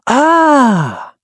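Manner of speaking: high, drawn-out falling "ah"; realization "ah". Acted. Tony finally realizes his mistake, dawning "ah". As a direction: let out a long "ah" of sudden insight. Acts out Tony's sudden realization.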